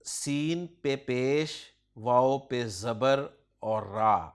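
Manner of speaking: very slow letter-by-letter spelling. Shown as a demonstration. Teacher spelling the plural for his student, very slowly. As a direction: spell each vowel mark out deliberately. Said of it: "سور".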